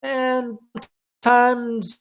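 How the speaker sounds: "And sometimes" held up very high; high drawn-out pitch. Shown as a demonstration. Demonstrating varied intonation while explaining it.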